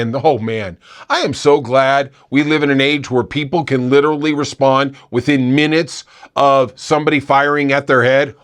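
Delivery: usual speaking voice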